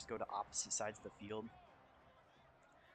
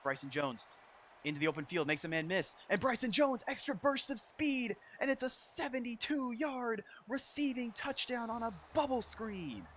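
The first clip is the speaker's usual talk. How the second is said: high, loud, drawn-out touchdown call; excited play-by-play; 8 semitones higher, 3 dB louder than usual. Real, not acted. Gamer calls Bryson Jones's long touchdown, thrilled play-by-play. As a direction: build to a soaring, hyped commentator's cry.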